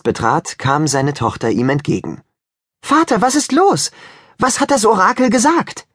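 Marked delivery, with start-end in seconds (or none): 2.8-5.9 put-on much higher, bright girlish voice of a worried daughter; young daughter voice. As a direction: daughter anxiously asking her father. Daughter greets returning father.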